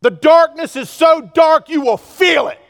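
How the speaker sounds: loud, high preaching shout; a preacher's full-force shout; shouted warning. Real, not acted. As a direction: hammer the warning home.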